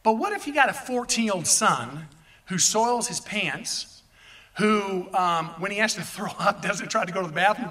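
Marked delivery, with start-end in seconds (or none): none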